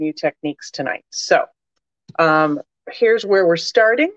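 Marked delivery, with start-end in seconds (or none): 2.2-4.1 talking around a cough drop; cough drop in mouth. Real, not acted. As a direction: speak with a lozenge in your mouth. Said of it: "I have a cough drop in my mouth".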